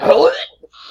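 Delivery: sudden high laughing squeal; a quick high burst of laughter; laughing yelp. Retold or imitated. Body reacts, mock recoil.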